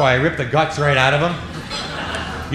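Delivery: normal talking voice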